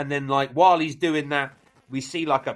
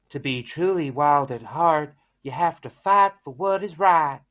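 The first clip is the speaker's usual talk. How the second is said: Good Witch; 3 semitones higher, 3 dB louder than usual. Acted. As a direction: lift the pitch a little into a Southern fairy-guide voice. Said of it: "so the Good Witch tells him".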